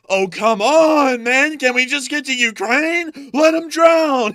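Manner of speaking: high, loud, slowed whine; comic Anthony. Acted. Anthony brushing off the drowning man, high exasperated whine. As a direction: brush them off impatiently. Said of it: "Anthony sat there and said".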